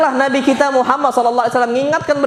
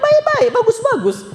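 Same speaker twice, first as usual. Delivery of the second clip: much higher, urging; emphatic plea. Acted. Preacher urges couples to part kindly, high emphatic plea. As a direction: urge it gently but high.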